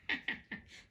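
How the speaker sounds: a soft breathy laugh; quiet laugh. Real, not acted. Reader laughs quietly at own pun. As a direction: chuckle at the pun.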